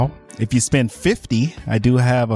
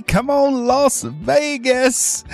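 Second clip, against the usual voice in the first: hyped shout; 13 semitones higher, much slower than usual. Real, not acted. Calling out to city.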